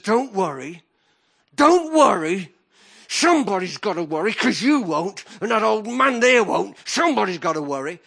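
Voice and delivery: loud, high, tearful angry shout; mum's angry outburst. Retold or imitated. Mother shouting at her seven-year-old son, angry and tearful. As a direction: shout it like a desperate mother in tears. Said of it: "with all tears in her eyes and anger".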